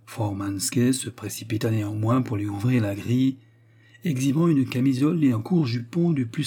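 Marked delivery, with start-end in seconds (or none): none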